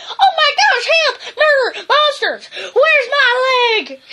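timid dog squealing in terror; squeaking Drover. Acted. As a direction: squeak it out in a high panic, half laughing.

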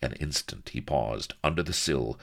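ordinary delivery

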